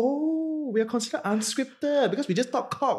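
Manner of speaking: laughing Singlish self-quote; high, amused Singlish remark. Retold or imitated. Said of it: "I was like".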